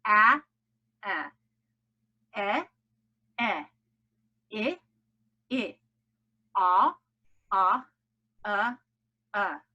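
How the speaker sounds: "a, e, i" as short vowels of cat, bed, sit, then hot and cup; each short vowel alone, said twice with pauses; short vowel sounds. Shown as a demonstration. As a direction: echo drill of isolated short vowels. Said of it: "when they are short".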